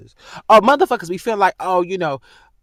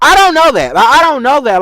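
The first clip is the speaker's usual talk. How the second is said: loud, higher, incredulous outburst; exasperated outburst; 7 semitones higher, 8 dB louder than usual. Real, not acted. Vlogger fed up with a rumour, loud disbelief. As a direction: blurt it out in disbelief. Angry rant about rumour.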